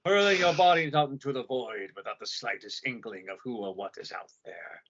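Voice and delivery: lightly voiced movie villain; a hint of a grand villain addressing foolish mortals; Ming the Merciless. Villain hurling humans into the void, lightly hammed-up. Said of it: "Wyoming the merciless".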